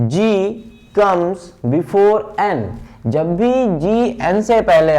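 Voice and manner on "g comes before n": G comes before N spoken very slowly; slow rule. Shown as a demonstration. Teacher spells out the rule to students slowly. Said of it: "तो आपने देखा जब भी".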